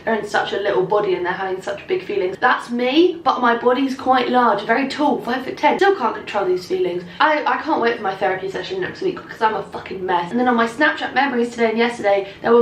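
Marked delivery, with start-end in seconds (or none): none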